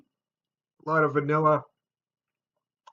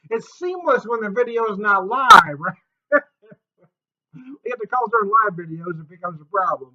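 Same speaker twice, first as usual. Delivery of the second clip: laughing; 7 semitones higher, 9 dB louder than usual. Real, not acted. Amused after wife's call.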